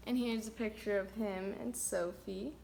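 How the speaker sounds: usual speaking voice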